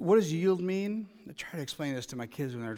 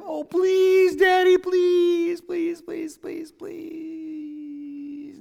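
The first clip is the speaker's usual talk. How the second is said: put-on begging child; 14 semitones higher, 9 dB louder, much slower than usual. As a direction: beg like a pestering child, loud at first then trailing off. Strong-willed child begging daddy.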